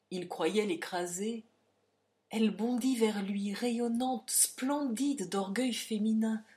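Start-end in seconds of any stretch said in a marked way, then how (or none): none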